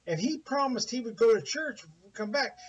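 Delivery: normal talking voice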